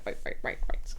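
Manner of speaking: "right, right, right" whispered under the breath; whispering. Real, not acted. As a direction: whisper each "right" to yourself.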